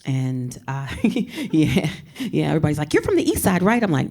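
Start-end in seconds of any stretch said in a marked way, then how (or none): 2.9-3.8 high, teasing quote of a stranger; mock nosy questioner. Mocking people who assume she is from the east side, high and teasing. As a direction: squeak out the nosy question, much higher.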